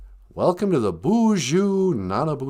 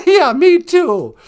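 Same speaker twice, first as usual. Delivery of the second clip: high laugh; 8 dB louder, slower than usual. Real, not acted.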